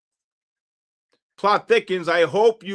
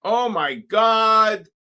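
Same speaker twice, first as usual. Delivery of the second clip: drawn-out shout; 5 semitones higher, 4 dB louder, much slower than usual. Real, not acted. Market spikes, shouting.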